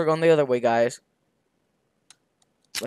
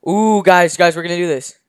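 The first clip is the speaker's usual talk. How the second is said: usual speaking voice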